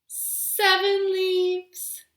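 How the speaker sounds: slow singsong counting; counting rhyme. Acted. Storytime host counting leaves with children, singsong. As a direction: count along slowly with the kids.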